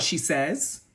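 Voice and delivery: usual speaking voice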